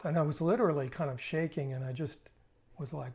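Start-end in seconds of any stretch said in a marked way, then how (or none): none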